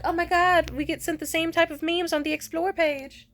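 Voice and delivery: high, loud, gushing imitation; squealing mock enthusiasm; mock gushing friend. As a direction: ham up a fake excited fan. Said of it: "Just like".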